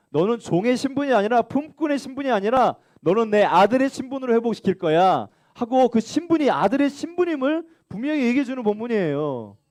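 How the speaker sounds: normal talking voice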